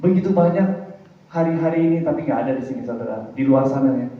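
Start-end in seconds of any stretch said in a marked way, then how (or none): none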